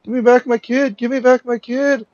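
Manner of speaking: high, loud frantic mother's cry; high, loud and desperate, begging for her kid back; retold screaming. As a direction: shriek it like a panicked mother. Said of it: "this woman's coming screaming".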